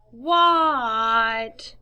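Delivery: loud drawn-out call back; child yelling back; yelled. A narrator's reading. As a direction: shout back from outside. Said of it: "yelled Froggy".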